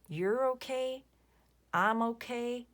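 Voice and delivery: repeating a movie line in a light Southern lilt; movie quote, light accent. Pretend. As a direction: quote the film with a hint of drawl. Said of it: "what movie that is a quote from".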